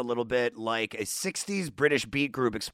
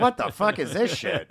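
higher-pitched, swooping incredulous outburst; baffled disbelief; 7 semitones higher than usual. Retold or imitated. Recalling the flamethrower arms at a gig, in mock disbelief. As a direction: react in mock disbelief, voice jumping up. Quoting own baffled reaction at concert.

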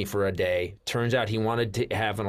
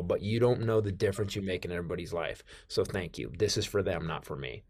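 much softer and lower, heartfelt; sincere, softening; 4 semitones lower, 6 dB quieter than usual. Retold or imitated. Fan telling [name] what he means, softly and sincerely. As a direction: let it fade into quiet thanks. Sincere thanks to his hero.

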